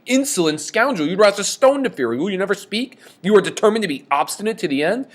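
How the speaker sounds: high, loud furious outburst. Retold or imitated. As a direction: rail at the stubborn seer. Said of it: "he gets mad really quickly here".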